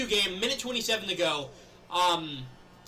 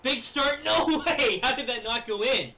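excited shouting; 6 semitones higher, 5 dB louder, slower than usual. Real, not acted. Exciting goal chance in game.

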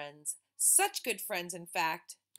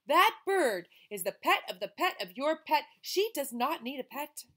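firm mother; 5 semitones higher, 8 dB louder than usual. Acted. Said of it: "said the boy's mother".

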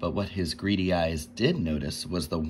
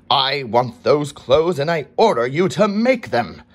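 louder and higher, a king losing his temper; king furious at the boy's refusal; angry king; 6 semitones higher, 10 dB louder than usual. A narrator's reading. King's scowling demand.

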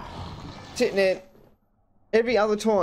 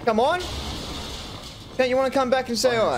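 taunting; 6 semitones higher than usual. Real, not acted. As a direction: goad the enemy who ran off. Taunting a fled enemy.

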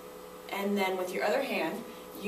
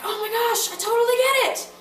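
much higher and louder, fast "I totally get it!"; excited realization; 12 semitones higher, 6 dB louder, faster than usual. Shown as a demonstration. Teacher acting out a student who suddenly gets it. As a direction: burst out with sudden understanding. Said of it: "you're like".

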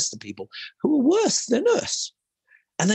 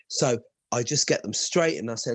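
normal talking voice

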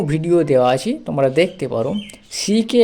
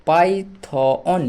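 Python stretched very slowly, syllable by syllable; drawn-out word; much slower than usual. Shown as a demonstration. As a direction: draw out Python slowly like a teacher. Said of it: "ওএন অন". (Python ধীরে টেনে উচ্চারণ)

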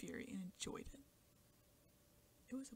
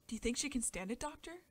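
anxious mother; 4 semitones higher, 7 dB louder than usual. A narrator's reading. Anxious mother asks the doctor.

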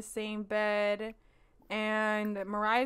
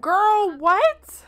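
high and loud disbelief; shocked; 9 semitones higher, 8 dB louder than usual. Real, not acted. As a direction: react like you can't believe it. Shocked by sim's behavior.

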